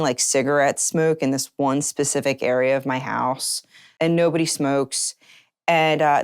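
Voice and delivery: ordinary delivery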